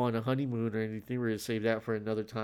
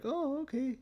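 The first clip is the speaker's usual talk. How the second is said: high mock reply; 4 dB quieter than usual. Mock reaction to new job title.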